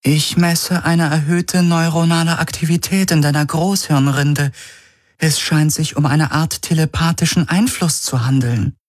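a calm machine giving a diagnosis; ship computer. Acted. Ship AI Helix reports.

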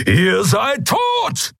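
slow, high-pitched furious roar; roared threat; thundering shout. Acted. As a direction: thunder a death threat, stretching each word. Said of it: "donnerte Keru".

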